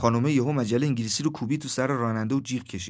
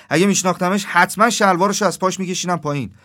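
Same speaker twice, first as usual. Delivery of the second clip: lightly voiced indignant woman, raised in pitch and volume; a touch higher and louder, as an indignant passenger; riled woman passenger; 5 semitones higher, 6 dB louder than usual. Woman passenger answering the taxi driver about the young man, mildly indignant.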